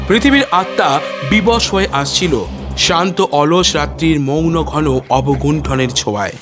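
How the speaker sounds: ordinary delivery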